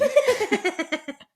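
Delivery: loud, high laugh; big high-pitched cackle; burst of laughter. Real, not acted. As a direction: burst out laughing at your own joke. Laughs at own self-mocking joke.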